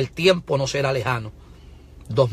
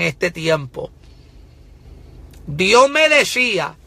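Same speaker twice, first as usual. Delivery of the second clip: raised, loud prophetic preaching; fiery pulpit proclamation, higher and louder; full-force preaching; 7 semitones higher, 6 dB louder than usual. Real, not acted. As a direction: proclaim it like a prophet warning the nation.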